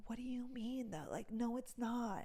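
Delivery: hushed, slowed-down aside whisper; whispering to the dentist, hushed; mock whisper. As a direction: breathe it like a secret complaint. Retold self-quote to dentist.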